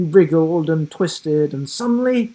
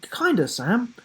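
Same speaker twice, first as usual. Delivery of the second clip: put-on a bit higher boyish voice; lightly voiced boy; 8 semitones higher than usual. Will introducing his friend to the giraffe, lightly boyish. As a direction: speak as young Will, a touch higher.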